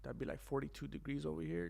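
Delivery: hushed whisper; whispered muttering to himself; whispered aside. Real, not acted. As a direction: breathe it out under your breath.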